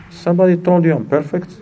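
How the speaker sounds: ordinary talk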